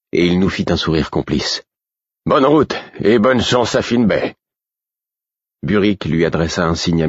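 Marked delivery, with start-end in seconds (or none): 2.2-4.3 high, hearty send-off; called farewell. A narrator's reading. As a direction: call good luck after departing riders. Captain calls farewell to riders. (adieu lancé d'une voix haute)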